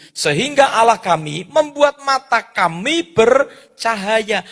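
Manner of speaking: solemn reading from Ezra; scripture reading. Acted. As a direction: proclaim the verse with weight. Reads scripture aloud.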